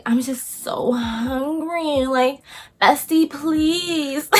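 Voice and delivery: slow, soft pleading whisper sinking to breath; whiny needy friend. Retold or imitated. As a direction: beg like a helpless hungry girl. Same friend voice again.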